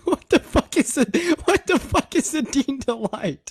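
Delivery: high, drawn-out incredulous question; can't believe what he's hearing; baffled disbelief. Real, not acted. Baffled, laughing at the name.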